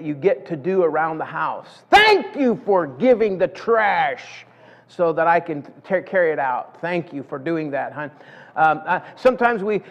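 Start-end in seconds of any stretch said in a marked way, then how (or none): 1.9-8.1 sarcastic thanks. Pretend. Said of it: "you're saying thank you sarcastically".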